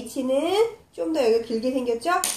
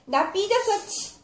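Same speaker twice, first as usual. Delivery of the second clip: higher-pitched pretend huff ending in a short "치"; mock sulking; 8 semitones higher than usual. Teacher acting miffed to teach the ch sound, mock sulk. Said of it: "소리 나는 거".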